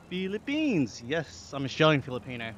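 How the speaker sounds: ordinary talk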